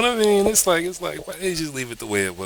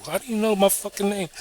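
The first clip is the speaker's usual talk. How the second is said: alarmed woman; 13 semitones higher than usual. Retold or imitated. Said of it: "she'd be like".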